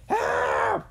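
high, loud shriek for help; crying out. A narrator's reading. Jimmy plunging down the shaft, crying out. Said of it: "he cried".

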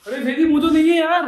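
high, loud cry of alarm. Real, not acted. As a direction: report a missing pet in alarm, voice shooting up. Discovers the pet is missing. (घबराकर ऊँची आवाज़ में)

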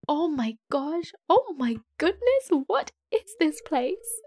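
slow, high-pitched awe; thrilled, stretched-out disbelief; excited. Real, not acted. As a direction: draw it out in rising amazement. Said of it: "I was so excited then".

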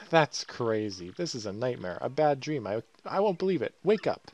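hammed-up disbelief. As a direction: refuse to believe it, climbing toward a cry. Character panics at a supposed nightmare.

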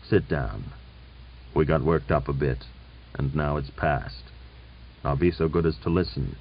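low, soft, subdued conciliation; quite quietly. A narrator's reading. General calming things after a quarrel, quietly. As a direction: settle down and speak quietly. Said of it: "Then, quite quietly".

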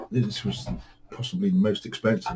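normal talking voice

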